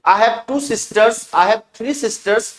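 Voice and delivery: slow model sentences. Shown as a demonstration. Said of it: "teacher models plural sentences".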